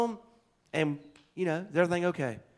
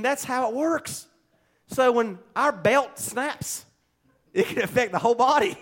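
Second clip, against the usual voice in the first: ordinary delivery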